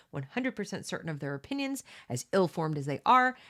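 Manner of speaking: ordinary delivery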